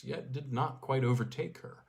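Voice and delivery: ordinary delivery, nothing marked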